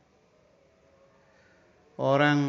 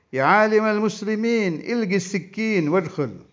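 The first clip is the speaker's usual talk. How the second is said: kafir calling out high and loud to the visiting scholar; 9 semitones higher, 6 dB louder than usual. Acted. The kafir calls out to the scholar at his door, high and loud. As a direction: call him in from the doorway, higher and louder.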